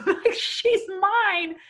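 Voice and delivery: breaking into a real laugh, voice jumping up; laughing through the words, ending high and drawn out; amused wonder. Real, not acted. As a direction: laugh in proud disbelief. Marvels at her daughter, laughing.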